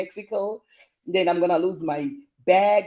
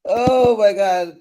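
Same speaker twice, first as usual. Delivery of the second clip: amused exclamation; 8 dB louder, slower than usual. Real, not acted. Reacting to a funny comment.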